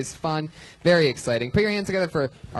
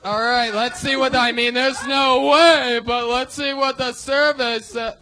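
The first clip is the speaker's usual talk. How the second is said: higher-pitched, drawn-out incredulous host; Steve Harvey; 5 semitones higher, slower than usual. Retold or imitated. Comic retells the host reacting to an answer, incredulous host impression. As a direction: imitate a TV host in disbelief, slowing down. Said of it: "when he, when he goes".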